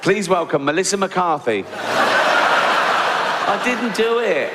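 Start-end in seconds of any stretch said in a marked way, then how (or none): 1.7-4.5 laughing protest. Real, not acted.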